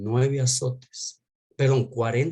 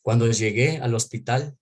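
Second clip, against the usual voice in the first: ordinary delivery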